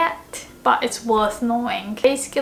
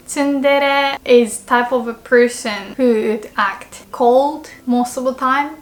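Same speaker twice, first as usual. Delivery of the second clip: usual speaking voice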